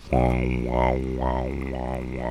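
low, droning didgeridoo noise; imitated didgeridoo. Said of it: "It sounds like a didgeridoo".

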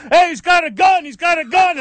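high alarmed retold shout; yelling. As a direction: shout the warning. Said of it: "then he yelled out".

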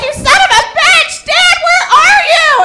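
put-on high, loud drunken yelling; mock drunken shriek; drunk screaming. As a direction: scream like a wasted girl on a gurney. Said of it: "They're like".

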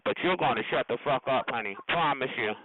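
ordinary talk, nothing marked

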